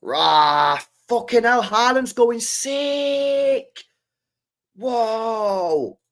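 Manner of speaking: high, loud real shout of delight, slowing on going sick; excited outburst. Real, not acted. Fan watching Haaland score bursts out, excited and high. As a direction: cheer as the striker scores. Reacting live to a Haaland goal.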